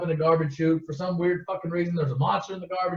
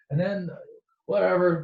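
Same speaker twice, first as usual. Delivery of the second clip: usual speaking voice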